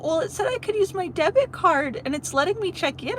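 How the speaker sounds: high-pitched defensive protest; indignant pleading. Retold or imitated. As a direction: defend yourself, voice climbing up. Retelling own indignant reply.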